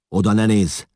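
high, loud warning; shouting. A narrator's reading. Father shouts a warning to his son, high and loud. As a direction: shout a forbidding order. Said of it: "kiáltott a fiára".

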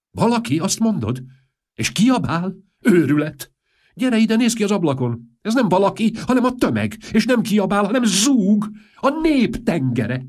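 high, loud frantic exclamations; frantic shouting king; panicked outburst. Acted. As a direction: cry out in rising panic.

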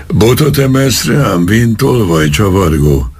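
high-pitched indignant fury, narrated without shouting; foaming anger. A narrator's reading. Policeman accuses vagrant of raising a stick, high-pitched anger. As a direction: rage at an affront, pitched well up. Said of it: "tajtékzott haragosan".

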